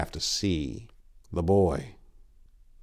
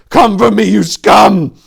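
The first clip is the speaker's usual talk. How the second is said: loud spitting defiance; shouted defiance at a monster; spat challenge; 13 semitones higher, 15 dB louder than usual. A narrator's reading. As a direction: hurl the challenge at the beast. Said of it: "Tor spat".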